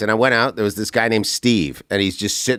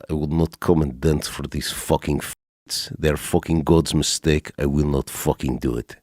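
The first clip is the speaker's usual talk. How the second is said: lightly voiced Russian military man, low; Russian accent; 7 semitones lower, 4 dB quieter than usual. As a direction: imitate a stubborn Russian refusing, low-pitched. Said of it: "Russian military guy".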